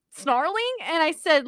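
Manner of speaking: usual speaking voice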